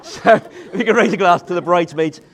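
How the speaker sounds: giggling through the toast; laughing while talking. Real, not acted. Laughing through best man joke.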